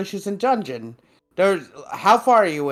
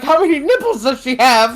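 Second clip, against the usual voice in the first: eager question; 10 semitones higher, 8 dB louder than usual. Retold or imitated. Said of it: "And Laios is like".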